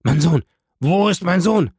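loud, high, desperate demand; frightened cry. A narrator's reading. Father demands his son from the servants, crying out in fear. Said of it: "Ton der Angst".

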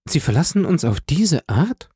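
höher, am Ende steigend; ungläubig protestieren; bestürzte Frage. A narrator's reading. Julie protestiert gegen die Abreise. (gekränkte Schwester, hoch fragend)

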